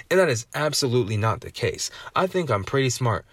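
usual speaking voice

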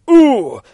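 high, loud "ooh!"; startled pained grunt. Acted. Judge shoved against the wall, startled high cry. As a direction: cry out on impact.

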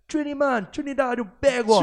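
high, hammed-up Trinidadian accent; Trini accent. Shown as a demonstration. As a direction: put on a loud Trini lilt. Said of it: "Or Trini accent".

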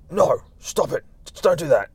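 a hint of a cross owner telling off his pet; scolding dog owner.

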